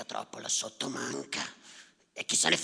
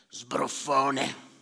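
usual speaking voice

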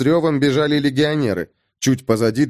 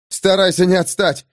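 breathless running; 5 semitones higher than usual. Acted. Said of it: "на бегу выдохнул".

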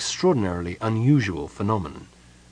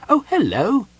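high, bright-eyed kender chirp; perky kender greeting at the door; 13 semitones higher, 5 dB louder than usual. A narrator's reading. Kender greets Palin at the door, high and perky.

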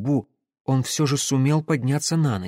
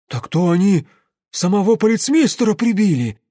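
raised, loud astonished exclamation; shocked gasp; 7 semitones higher, 6 dB louder than usual. A narrator's reading. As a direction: soldiers aghast at the discovery. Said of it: "охнули среди улан". (потрясённое громкое оханье)

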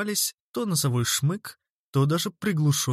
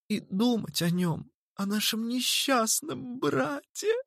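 voice breaking; 6 semitones higher than usual. A narrator's reading. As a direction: let the voice crack into sobs. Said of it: "Голос Анджелы сорвался и потонул в глухих рыданиях".

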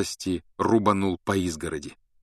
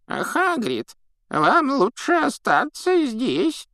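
put-on old prospector; much slower than usual. A narrator's reading. Old man continues.

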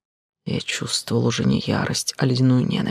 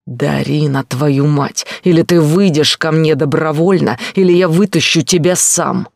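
roaring at her, rising louder; furious shout; 3 semitones higher, 7 dB louder than usual. A narrator's reading. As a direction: bellow the threat, building up. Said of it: "Прокричал".

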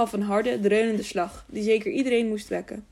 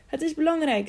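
higher, pressing urgency; urgent; 7 semitones higher than usual. A narrator's reading. Tiuri begging the innkeeper at night, urgent. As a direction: plead it quickly, it matters. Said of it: "zei Tiuri op dringende toon".

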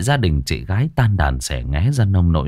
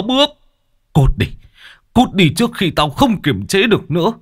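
angry; 6 semitones higher than usual. A narrator's reading. Angry husband throws her out.

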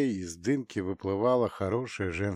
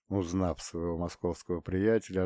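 usual speaking voice

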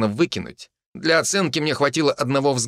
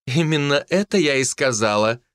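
amused; 4 semitones higher than usual. Acted. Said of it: "вновь послышались нотки веселья".

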